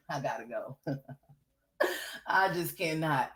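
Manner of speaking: can't stop laughing at the absurdity; laughing off the streamer's story in disbelief. Real, not acted.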